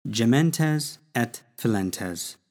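"Gementes et filentes" spoken slowly, every syllable given clearly; slow Latin demo. Shown as a demonstration. Teacher sounding out new Latin words slowly for students. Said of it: "how do we say mourning and weeping".

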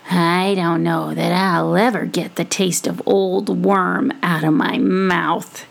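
regretful. Acted. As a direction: grumble it with disgust for the taste. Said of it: "he said regretfully".

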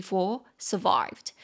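four survived read very slowly for learners; slow, spelled-out model reading. Shown as a demonstration. Teacher slowly models four survived for learners. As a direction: drag out four survived so students catch each word. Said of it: "Survive两个音节".

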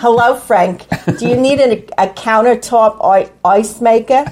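mother impression slipped into Cockney; accidental Cockney. Wife mimics mother-in-law offering a gift, wrong Cockney accent. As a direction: badly imitate a mother, landing on a Cockney accent. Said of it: "I'm doing Cockney, for heaven's sake".

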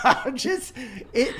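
high laughing speech. Real, not acted. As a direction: talk through laughter. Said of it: "laughing while talking".

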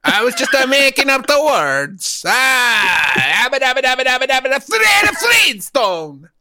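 high loud gibberish Italian; thick Italian accent. Retold or imitated. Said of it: "using a thick Italian accent".

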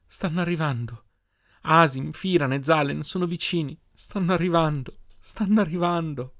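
high-pitched, emotional urgency; breaking the news through tearful excitement; insistent repeating. A narrator's reading. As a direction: repeat the news, overcome with feeling. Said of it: "Continuò a ripetere".

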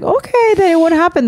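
high-pitched, soothing mom voice; placating parent. Retold or imitated. Mother giving in to her son about siblings, high placating voice. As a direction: placate a small child in a high voice.

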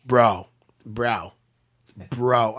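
repeated emphatic one-word exclamations, each falling away; Mark Henry. Retold or imitated. Retelling a trainer's raving about a fighter, imitated emphatic exclamations. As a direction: drop each one hard, with space between. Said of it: "That's what he says".

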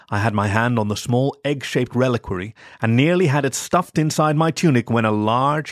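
usual speaking voice

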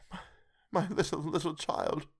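high, softened cry of grief; grieving father losing control; breaking down. A narrator's reading. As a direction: break down over a lost child. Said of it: "He broke down all at once".

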